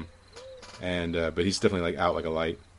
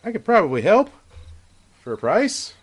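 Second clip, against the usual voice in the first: lightly voiced scheming merchant, higher; a hint of a schemer; 11 semitones higher, 4 dB louder than usual. The buyer offers help to the friar, sly schemer voice. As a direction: dangle the offer slyly. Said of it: "And he's like".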